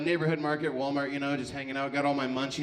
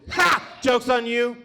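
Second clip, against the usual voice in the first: loud, high mock gotcha; spring the trap on the crowd; mock cop shouting gotcha; 7 semitones higher, 8 dB louder, slower than usual.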